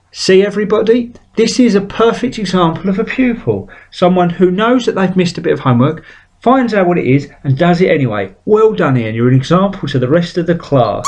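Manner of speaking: mock a gushing teacher holding him up as an example; praising teacher. Teacher praising Ian to the whole class, hammed-up pride.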